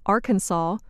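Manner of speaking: Arkansas as "AR-kun-saw", final S silent. Shown as a demonstration. Narrator explaining the French custom behind the state's name, silent final S. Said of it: "not pronouncing the final S".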